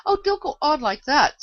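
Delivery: high, loud distressed plea; despairing. A narrator's reading. The poor Queen pleading with Alice, despairing. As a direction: beg her in despair to stop. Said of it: "wringing her hands in despair".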